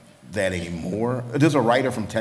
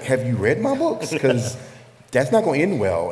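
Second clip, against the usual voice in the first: amused warning, pitched up; higher, louder joking comeback; incredulous retort; 5 semitones higher, 5 dB louder than usual. Retold or imitated. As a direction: push back in mock disbelief with a laugh. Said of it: "And I'm like".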